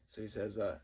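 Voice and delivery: normal talking voice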